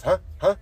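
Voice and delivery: short, rising "Huh?" tacked on like a filler; punchy questioning "Huh?", mimicking a coworker; chasing "huh?". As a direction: imitate a verbal tic, "Huh?" popped up high and repeated. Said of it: "chase it with a "huh?".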